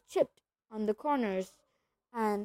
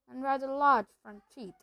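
usual speaking voice

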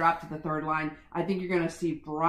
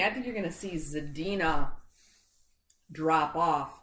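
ordinary talk

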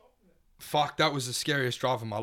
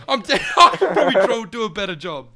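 sarcastic cheer; 9 semitones higher, 13 dB louder than usual. Retold or imitated. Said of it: "I've already had, like, a couple drinks".

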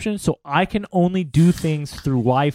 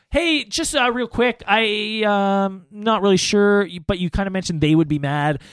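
tentative interjection; 3 semitones higher than usual. Retold or imitated. Puts a hand up to interrupt the conversation, tentative. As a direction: politely try to get a word in, pitched up. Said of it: "I've got my hand up in the corner".

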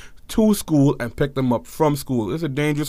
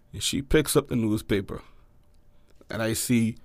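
normal talking voice

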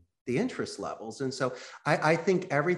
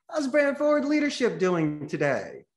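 bright, much higher, peppy question to the receptionist; upbeat sales greeting; 10 semitones higher than usual. Acted. Salesman on an outbound call, greeting the assistant with a much higher, upbeat lift. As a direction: sell the company name with cheerful energy.

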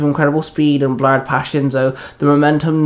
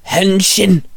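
raised-pitch transformation call; 5 semitones higher than usual. A narrator's reading. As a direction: call it out like a hero's command. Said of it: "Shinzo called out".

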